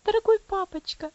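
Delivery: put-on high child's voice, begging and tearful; pleading through tears. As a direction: plead with him, near tears. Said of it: "звучала мольба и слёзы". (высоким голосом, с мольбой и слезами)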